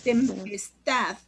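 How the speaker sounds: "Tempestad" slowly split, last syllable "tad" drawn out; stress on final syllable. Shown as a demonstration. Teacher shows class the tonic syllable, "Tempestad" slow with stressed ending. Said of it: "Ahí la sílaba tónica estad".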